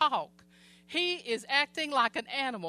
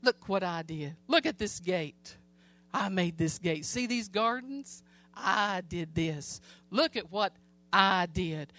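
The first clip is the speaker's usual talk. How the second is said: a lightly voiced proud king, lower; boastful king; 5 semitones lower than usual. Preacher voicing the proud king admiring his palace, lightly boastful. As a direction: gloat over your own works, a hint of a boastful king. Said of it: "he was walking going".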